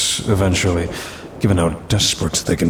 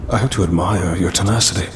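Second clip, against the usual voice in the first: usual speaking voice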